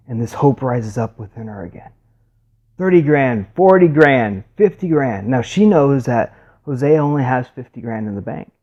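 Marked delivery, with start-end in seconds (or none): 2.8-5.3 raised, loud bid calling; auctioneer. Retold or imitated. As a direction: call out the rising bids to a crowd. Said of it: "the auctioneer starts".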